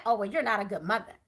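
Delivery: mocking quote. Mocking family's judgment.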